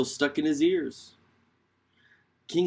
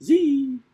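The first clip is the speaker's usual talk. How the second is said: high, loud buzzing zee; mosquito whine; 10 semitones higher, 7 dB louder than usual. Acted. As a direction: whine like a mosquito at your ear. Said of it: "she goes out whining in people's ears".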